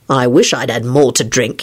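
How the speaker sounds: low, mildly irritable reply. A narrator's reading. Lupin answers drunken Neville, irritable. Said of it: "he replied irritably".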